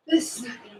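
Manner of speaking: breathless whispered aside to self. Real, not acted. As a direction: whisper it to yourself mid-set. Muttered aside mid-workout.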